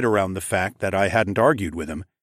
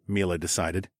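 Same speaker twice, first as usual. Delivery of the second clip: ordinary delivery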